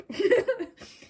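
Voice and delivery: high, loud giggle; a burst of high giggling at her own remark. Real, not acted. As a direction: giggle at yourself. Laughing at herself.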